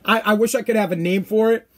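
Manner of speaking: ordinary talk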